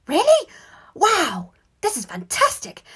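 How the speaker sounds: high, loud squeaky amazement; thrilled. A narrator's reading. Brother reacting to the great news, thrilled. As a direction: gush in a boyish squeal.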